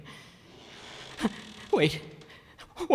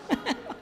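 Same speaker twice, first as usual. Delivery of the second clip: nervous relieved laugh; 12 semitones higher, 7 dB quieter than usual. Acted. Relief after scare.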